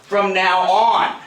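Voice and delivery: slow, loud bark; shout. Acted. Bully making the writer repeat the promise, slow shout. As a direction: drag out each word in a yell.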